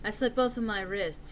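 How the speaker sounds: ordinary delivery, nothing marked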